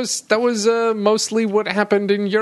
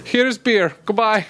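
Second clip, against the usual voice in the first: mock Croatian. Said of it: "quoted Croatian briefing".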